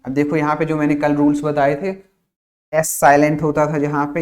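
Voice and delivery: ordinary talk, nothing marked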